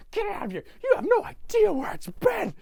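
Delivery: high, strained comic outburst; mock yell of disgusted alarm; Grinch. Grinch after Max bites him, high hammed-up yelp. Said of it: "And he's like".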